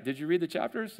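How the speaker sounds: usual speaking voice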